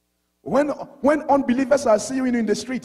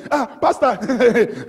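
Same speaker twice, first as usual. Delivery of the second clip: sheepish laugh; much slower than usual. Retold or imitated. Caught-out sister greets pastor.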